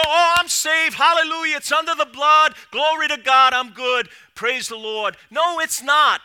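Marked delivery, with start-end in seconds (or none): 0.0-5.2 mock glib praise. Mocking glib church talk.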